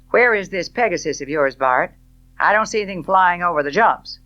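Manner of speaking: loud, fast, mocking man's voice; a sardonic gentleman calling out; mocking Rhett. A narrator's reading. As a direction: tease her with sharp amusement.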